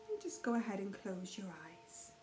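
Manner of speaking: soft, unhurried meditation voice with long pauses; calm, hushed guidance, leaving space after each phrase; guided meditation. Real, not acted. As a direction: gently guide listeners into awareness of breath and mind.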